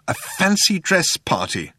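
slow, high, contained anger; trembling with anger. A narrator's reading. Arthur accuses Zaphod over the party, angry. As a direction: draw out the accusation indignantly. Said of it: "trembling with anger".

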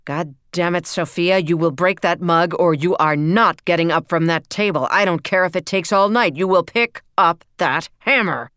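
loud, furious laying down the law; father furious at his defiant daughter; angry ultimatum. A narrator's reading. Father forces daughter, shouting.

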